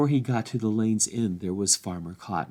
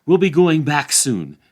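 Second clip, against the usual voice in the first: loud, raised reassurance to the pony; calling out; 7 semitones higher, 10 dB louder than usual. Acted. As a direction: shout over the horn. Sam calling to his pony.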